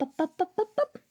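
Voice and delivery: usual speaking voice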